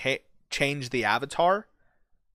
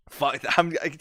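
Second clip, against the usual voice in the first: usual speaking voice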